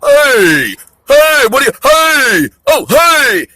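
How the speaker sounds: high, loud hollering hey; mock catcalling shouts. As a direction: yell at someone across the street. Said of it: "hitting is when you".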